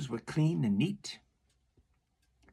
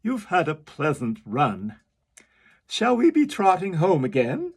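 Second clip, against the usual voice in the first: loud, higher carpenter; 5 semitones higher, 9 dB louder than usual. Acted. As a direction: cheerily call the oysters home. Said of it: "said the carpenter".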